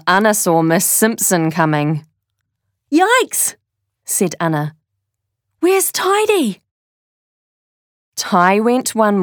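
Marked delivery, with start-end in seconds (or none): 2.9-3.6 sudden high panicked yelp; alarmed yelp. Acted. Anna sees teacher coming. 5.6-6.6 high, worried question; panicked. Acted. Anna panics about the robot.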